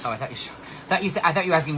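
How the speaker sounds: ordinary delivery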